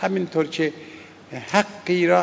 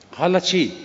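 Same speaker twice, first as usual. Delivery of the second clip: ordinary delivery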